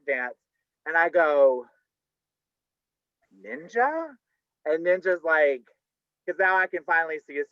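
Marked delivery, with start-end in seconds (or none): 3.4-4.2 startled high "Ninja?" of sudden recognition; surprised. Retold or imitated. Startled recognition of the stripper. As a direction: recognize an old friend in shock.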